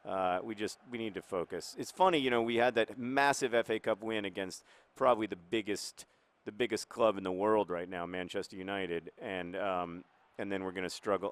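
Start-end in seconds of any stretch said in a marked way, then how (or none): none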